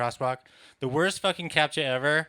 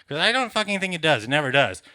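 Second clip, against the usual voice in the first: mildly irate, louder comic outburst; exasperated rant; 5 semitones higher, 8 dB louder, faster than usual. Acted. Comic ranting about the captcha program, exasperated. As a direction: vent loudly with a touch of exasperation.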